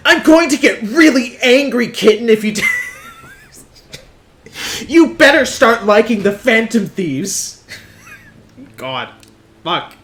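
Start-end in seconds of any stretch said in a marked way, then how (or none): 0.1-7.7 high, loud squeaky fake-angry threat; a cartoon boy trying to sound menacing; Deku as a daddy. Streamer redoing the daddy meme voice as Deku, high squeaky threat. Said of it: "what Deku sounds like if he was a daddy".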